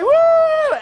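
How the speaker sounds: put-on very high drunk party "woo"; gleeful whoop. Drunk friend greeting a sober one, mock gleeful whoop.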